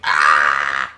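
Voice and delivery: loud, low cry of pain; pained goblin yell. Acted. Goblin spat in the eye, long loud yell. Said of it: "spit into his eye".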